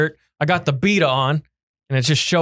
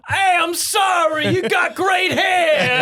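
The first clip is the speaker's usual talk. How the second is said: slow, high mock apology; shouting; 12 semitones higher, slower than usual. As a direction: yell a sheepish apology. Hecklers apologizing.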